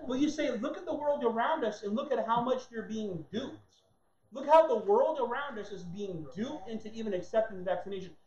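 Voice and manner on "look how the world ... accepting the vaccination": ordinary delivery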